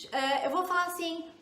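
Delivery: ordinary talk, nothing marked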